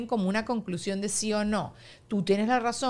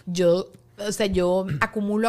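ordinary talk, nothing marked

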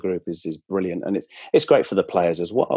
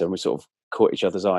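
ordinary delivery

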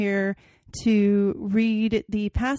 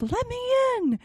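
put-on much higher, wailing child voice; wailing ghost child; 14 semitones higher than usual. Ghost girl wailing at the window, high plea. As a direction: wail it up high. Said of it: "Still it wailed".

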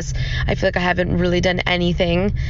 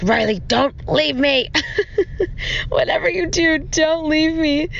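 pleading, half-laughing, higher; begging him not to go, playfully; mock pleading; 7 semitones higher than usual. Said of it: "I was like".

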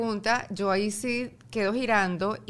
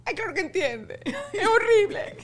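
laughing; 12 semitones higher, 5 dB louder than usual. Real, not acted. Laughing at own story.

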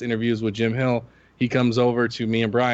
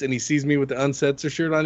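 normal talking voice

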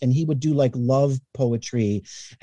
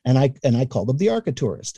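ordinary talk, nothing marked

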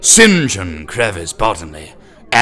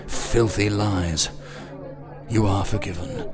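softer comforting pastor voice; soothing; 8 dB quieter than usual. Acted. St. John comforting sobbing Nooney, softly forgiving. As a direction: soothe him and absolve him.

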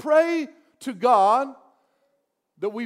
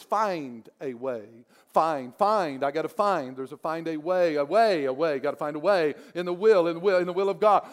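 lower, musing repetition leaning on "find" and "way"; repeating with emphasis; 4 semitones lower than usual. Shown as a demonstration. Pastor shows how he meditates on a verse, lower and musing. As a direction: turn "find a way" over and over, stressing each word. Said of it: "he'll start emphasizing certain words".

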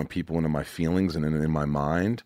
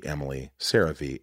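ordinary talk, nothing marked

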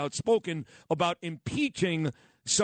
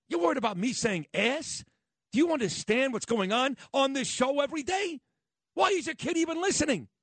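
high-pitched, exasperated rhetorical questions; indignant; 9 semitones higher than usual. Real, not acted. Radio host scolds listeners who complain about swearing, indignant. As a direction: push the pitch far up in disbelief, without shouting.

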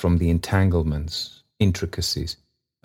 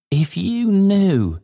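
slow, very high entreaty; earnest, high-pitched character voice; earnest plea; 13 semitones higher, 3 dB louder, slower than usual. A narrator's reading. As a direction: implore them, drawing the words out high. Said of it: "clasping his hands earnestly".

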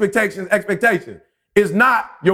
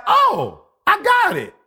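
high, bright "oh, I got it" exclaimed much higher; mock eureka, pitched way up; sudden realisation; 9 semitones higher than usual. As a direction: exclaim it like a light bulb going on.

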